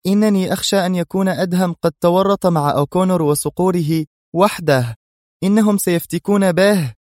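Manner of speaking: high, anxious woman's voice; worried. A narrator's reading. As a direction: fret aloud, pitched well up. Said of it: "وهي تقول في قلق".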